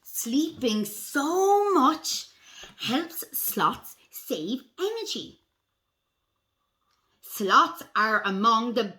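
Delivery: slow, deliberate storybook reading; picture-book read-aloud. A narrator's reading. Reading sloth facts to children, slow storybook voice. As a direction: read slowly to young children, weighting key words.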